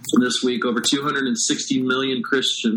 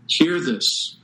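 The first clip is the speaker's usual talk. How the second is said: usual speaking voice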